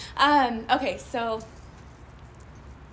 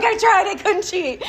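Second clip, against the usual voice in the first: higher, louder, laughing through it; laughing reply; 10 semitones higher, 13 dB louder than usual. Retold or imitated. Son answering his mother, laughing, higher and louder. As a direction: tease the son's answer with a laugh. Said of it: "He's like".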